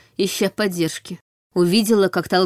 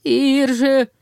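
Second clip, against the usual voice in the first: gurgling corpse; 5 semitones higher than usual. A narrator's reading. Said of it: "Забулькало".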